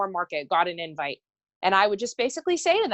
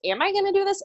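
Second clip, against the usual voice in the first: pitched way up, fretting over the question; anxious self-doubt; 12 semitones higher, faster than usual. Retold or imitated. Talking to herself on an old call, high anxious doubt. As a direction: let the worry jump the voice high.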